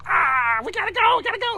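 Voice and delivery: high, loud panicked rushing; hammed-up panic about being late; frantic hurry. Husband acts out his loud frantic rush to leave. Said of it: "I'm like".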